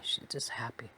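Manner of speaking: a soft unvoiced whisper; whispered aside. Real, not acted. Narrator whispers to the listener about grandma's joy. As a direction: breathe it out as a private aside.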